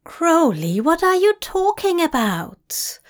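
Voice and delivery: high, loud demand. A narrator's reading. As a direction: demand an answer now. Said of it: "Zira demanded".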